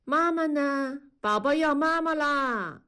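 put-on high, childlike whimpering voice; a small child crying for his mother; toddler calling for mama. Toddler outside the room crying for his mother, high childlike whimper. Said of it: "屋外大宝开始哭着找妈妈".

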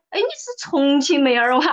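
high, loud imitation of a Chengdu local; local Sichuan dialect. Chengdu local questioning the actress's origins, in loud Sichuan dialect. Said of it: "我在成都说的时候，别人说".

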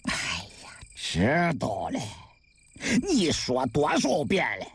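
low, irritated dialect grumble; weary, impatient low voice; irritated husband. A narrator's reading. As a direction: brush off a nagging wife. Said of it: "杜志康有些烦躁了".

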